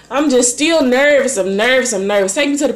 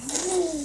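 short soft laugh; 8 semitones higher, 11 dB quieter than usual. Real, not acted. Laughing at her own story.

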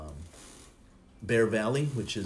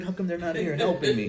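laughing mock complaint; 7 semitones higher, 4 dB louder than usual. Mock complaint about others not helping.

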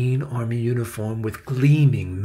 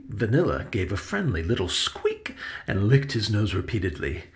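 usual speaking voice

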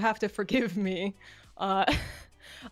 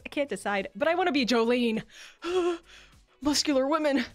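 usual speaking voice

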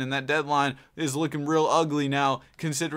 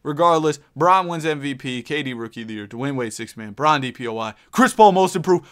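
usual speaking voice

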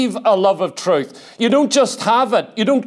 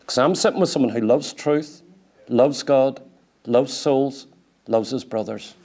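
tender fading; 7 semitones lower, 4 dB quieter than usual. Real, not acted.

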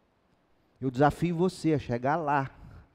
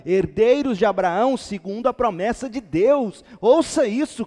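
loud, high, impassioned proclamation; full-force preaching; 9 semitones higher, 9 dB louder than usual. Real, not acted. Preacher telling believers they are Abraham's heirs, full-force preaching. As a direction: thunder the verses at the congregation.

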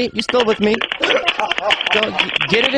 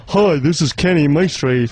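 low American-tinged DJ greeting; radio presenter voice; 5 semitones lower than usual. Shown as a demonstration. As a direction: drop into your on-air voice. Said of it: "when I'm on the radio".

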